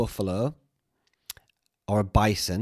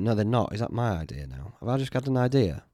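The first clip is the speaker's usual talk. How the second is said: normal talking voice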